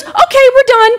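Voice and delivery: high, chipper sign-off; mock-cheerful photographer wrapping up; perky photographer. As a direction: announce it in a bubbly high voice. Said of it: "And so she goes".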